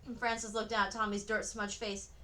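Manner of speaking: ordinary delivery, nothing marked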